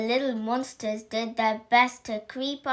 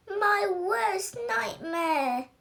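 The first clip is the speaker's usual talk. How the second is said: slow, much higher squeaky whine; squeaky dread; 9 semitones higher, slower than usual. Acted. Little werewolf dreading growls, slow and very high. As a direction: stretch it out high and small. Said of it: "squeakiest voice".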